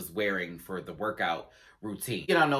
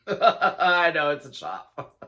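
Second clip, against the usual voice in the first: slow, helpless laughing through the words; 6 semitones higher, 7 dB louder, much slower than usual. Real, not acted. Vlogger owning up to a bad British accent, laughing. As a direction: crack up while admitting it.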